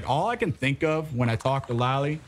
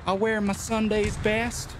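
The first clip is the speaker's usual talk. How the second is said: higher, folksy backwoods twang; put-on country bumpkin accent; mock hillbilly accent; 5 semitones higher than usual. Continues the hillbilly bit.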